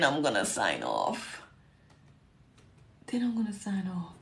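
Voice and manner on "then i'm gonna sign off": soft, half-whispered aside; hushed repeat. Real, not acted. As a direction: let it drop almost to a whisper. Weary softer repeat after two hours live.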